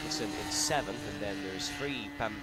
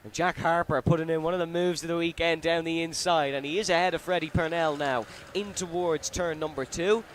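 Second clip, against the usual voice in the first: excited commentator; 3 semitones higher, 3 dB louder than usual. Real, not acted. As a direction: call the pass with rising excitement. Unusual overtaking move, live call.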